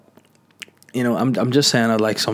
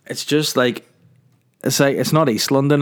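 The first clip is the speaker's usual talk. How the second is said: ordinary delivery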